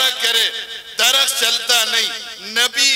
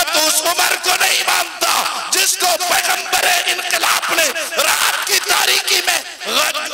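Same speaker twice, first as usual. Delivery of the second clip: fiery preaching shout; 7 semitones higher than usual. Acted. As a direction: thunder it with a laugh in the voice. Climax with laughter.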